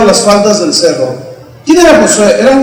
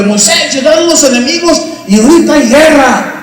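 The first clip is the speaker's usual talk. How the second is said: high, urgent calling out; breathless-messenger style warning, pitched high; acted alarm cry; 6 semitones higher than usual. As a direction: raise a war alarm, voice pitched up. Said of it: "el clamor del pueblo que gritaba".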